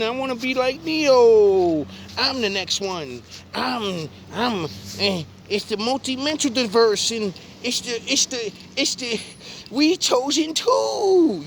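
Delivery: high whiny mock voice of a confused kid; childish imitation, climbing high. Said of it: "have them run around saying".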